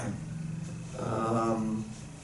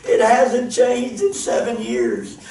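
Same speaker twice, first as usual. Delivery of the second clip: incredulous; 8 semitones higher, slower than usual. Retold or imitated. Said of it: "And I'm like".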